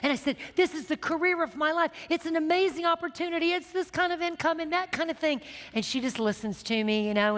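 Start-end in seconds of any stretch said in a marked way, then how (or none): 0.5-5.4 high-pitched, hyped-up retold pitch; gushing excitement. Woman telling her friend about the job, hammed-up excitement. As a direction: gush about it in a raised, eager voice.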